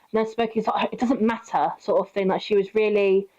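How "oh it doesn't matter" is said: ordinary talk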